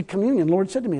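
ordinary talk

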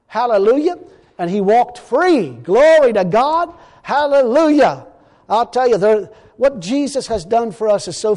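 loud praise with long, drawn-out words; exultant preaching. Real, not acted. Preacher praising God that the man walked free, exultant. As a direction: stretch each cry of praise, ringing out.

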